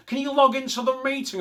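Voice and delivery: high, pushy voice asking him to log in; mock request. Comedian voices someone asking him.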